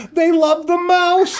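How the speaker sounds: high, laughing through the words; laughing punchline. Real, not acted. Host telling a computer cat joke, laughing high. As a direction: giggle out the punchline.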